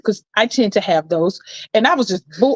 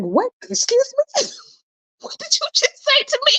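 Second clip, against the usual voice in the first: very high, far indignant squeal; high mock-offended squeal; shocked outrage.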